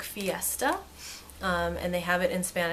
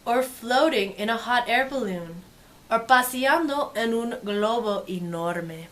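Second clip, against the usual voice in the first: lively, sing-song storybook read; reading to children; 4 semitones higher, 4 dB louder than usual. Acted. Teacher reading a picture book to kids, lively and sing-song. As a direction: make the page sparkle for little listeners.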